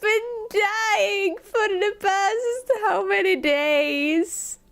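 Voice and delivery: high, drawn-out whiny lament; mock wailing. Sick streamer complaining to viewers, hammed-up tearful whine. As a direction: wail theatrically, as if close to tears.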